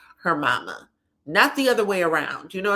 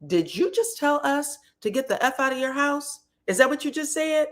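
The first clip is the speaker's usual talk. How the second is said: indignant disbelief; 8 semitones higher than usual. Retold or imitated. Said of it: "her sister says".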